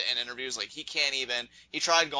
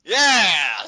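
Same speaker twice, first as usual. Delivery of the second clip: loud, raised-pitch whoop; mock shout; 4 semitones higher, 10 dB louder than usual. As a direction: punch it out like a hyped-up wrestler. Capping the acted shouting match.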